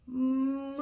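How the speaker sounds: long, drawn-out moo; soft-edged, lingering cow sound. Acted. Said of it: "A cow says".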